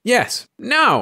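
high, emphatic cry; insistent cry. A narrator's reading. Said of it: "cried Missus Bellows".

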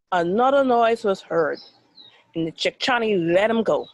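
usual speaking voice

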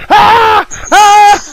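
two high, loud mock screams; human-like deer scream. Retelling the deer's fate to friends, mock human scream. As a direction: shriek like a deer that sounds like a person. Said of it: "screaming like a person".

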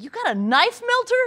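high-pitched, disbelieving question; incredulous dad. Retold or imitated. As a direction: imitate a dad's baffled disbelief.

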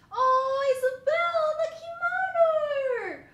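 very high, loud squeal of delight; overjoyed fan squeal at a cute card; squealing excitement. Real, not acted. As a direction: gush over her like a fan. Overjoyed at Isabel kimono card.

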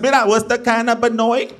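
normal talking voice

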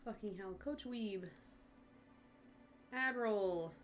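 usual speaking voice

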